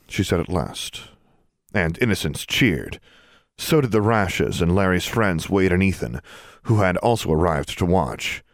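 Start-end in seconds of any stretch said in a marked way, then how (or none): none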